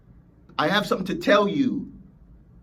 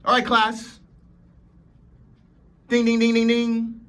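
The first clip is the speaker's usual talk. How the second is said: loud, higher mock schoolteacher calling class; playful teacher; 6 semitones higher, 6 dB louder than usual. Speaker playing a teacher calling the class to attention. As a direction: ring the bell and call the class to order. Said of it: "I'm here to teach you something".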